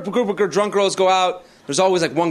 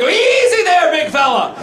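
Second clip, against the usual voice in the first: high, loud call to calm a horse; horse wrangler; 10 semitones higher, 4 dB louder than usual. Acted. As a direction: rein in a spooked animal, voice raised. Mimes calming an unruly horse.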